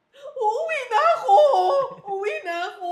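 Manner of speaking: high, loud wail wanting to go home; crying shout. Retold or imitated. Swimmer wailing to her coach, loud and tearful. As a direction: cry out that you're quitting. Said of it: "Sabi ko".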